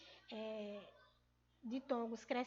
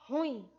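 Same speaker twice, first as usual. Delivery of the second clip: ruim with loud stress on IM; final stress; 5 dB louder than usual. Shown as a demonstration. Teacher modelling the tonic syllable, ruim stressed on IM. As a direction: hit the end of ruim, ru-IM. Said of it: "a sílaba tônica dela está no".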